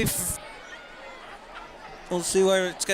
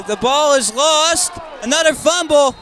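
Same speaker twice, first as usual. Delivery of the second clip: loud, high play-by-play burst; excited fumble call; 6 semitones higher, 6 dB louder than usual. Real, not acted. Commentator to viewers as the ball is lost, excited shout. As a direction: punch out the turnover with rising excitement.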